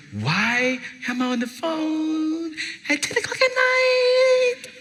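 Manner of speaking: high, drawn-out mock whine; hammed-up pleading complaint; exasperated. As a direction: stretch each phrase in weary exasperation. Retold exasperated question to crying student.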